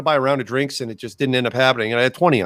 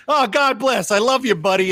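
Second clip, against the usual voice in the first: high-pitched Italian-American pizza man; hammed-up Italian affection; 9 semitones higher than usual. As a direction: imitate an effusive Italian guy, pitched up. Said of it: "It was stereotypical Italian, you know, accent and mannerisms".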